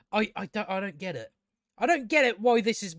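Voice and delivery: ordinary talk, nothing marked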